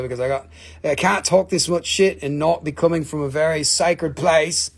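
usual speaking voice

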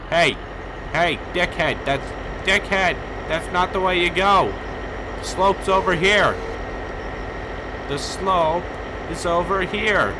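loud annoyed calling out; loud yelling. Real, not acted. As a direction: shout high and loud at the wandering skier. Yelling directions at wandering skier.

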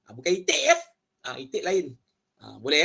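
ordinary talk

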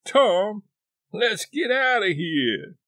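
frightened cry, high and loud; panicked plea to flee the cave; shaky voice. Acted. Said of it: "said with a shaky voice".

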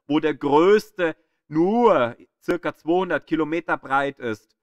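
normal talking voice

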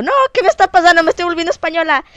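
high, loud, rushed mock alarm; mock panic. To herself after slipping into Spain's slang, mock panicked. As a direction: playfully freak out, fast and high-pitched. Acts out her panic at own slip.

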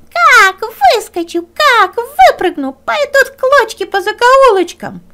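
high, loud threatening fox; menacing fox. Acted. Fox shouts threats from the stove, high and loud.

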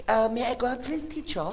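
soft, high, very slow request; hesitant little pupil asking permission; timid student. Acted. Student asking teacher to leave, meek hushed voice.

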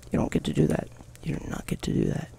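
tense whispered defiance. Real, not acted. Gamer whispering at the bear, tense. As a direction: hiss it under your breath.

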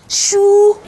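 high, loud, drawn-out whoop; dramatic exclamation. Acted. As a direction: blow out a big exclamation before the gossip. Exclaiming while starting to tell gossip.

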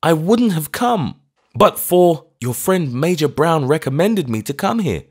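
high, loud, meek apology; meekly, high-pitched old clergyman; meek old vicar. A narrator's reading. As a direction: shrink as you say sorry. Old vicar explains visit.

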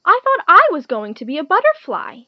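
high, loud, mildly puzzled protest; puzzled frog. A narrator's reading. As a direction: voice a confused storybook frog. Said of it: "the frog was puzzled".

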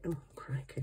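ordinary talk

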